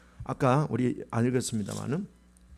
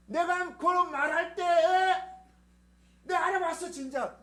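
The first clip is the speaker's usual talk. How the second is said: nagging parent. Retold or imitated. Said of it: "우리 엄마 아빠는 어떻게 해요?".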